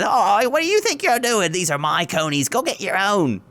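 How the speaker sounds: high, indignant lady voice; huffy and shrill-pitched; high indignant woman. Acted. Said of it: "she says".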